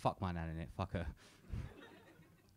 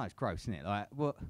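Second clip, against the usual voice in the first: normal talking voice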